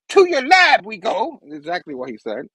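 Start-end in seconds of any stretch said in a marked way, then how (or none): 0.1-1.4 high, slow singsong villain voice; Goblin. Acted. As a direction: draw it out, pitched way up. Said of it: "He's like".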